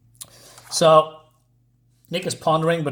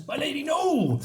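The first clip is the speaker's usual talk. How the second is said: pleading cry; 11 semitones higher, 4 dB quieter than usual. Acted. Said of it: "But then Alexander says".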